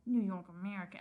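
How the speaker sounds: low, soft mumbled answer; mumble. Acted. Reporter mumbling his paper's name to Darius. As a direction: mutter it under your breath. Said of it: "I mumble".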